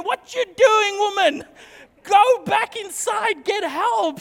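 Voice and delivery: high, loud, urgent yell; frantic shouting. Retold or imitated. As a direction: shout at her to go back in. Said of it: "And I'm going".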